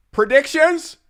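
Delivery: very high, loud excited shout; excited announcing. Acted. Reactor calls his album predictions, high excited shout. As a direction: squeal it out loud, far above your normal pitch.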